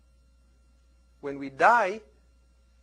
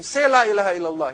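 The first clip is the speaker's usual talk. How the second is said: urgent plea; 4 semitones higher, 8 dB louder, faster than usual. Retold or imitated. Relatives urging dying man.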